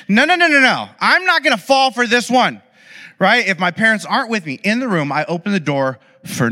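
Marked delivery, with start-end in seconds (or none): 0.1-2.6 high, loud string of noes; defiant kid. Retold or imitated. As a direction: refuse it like a smug little kid who won't be tricked. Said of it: "our kids are like".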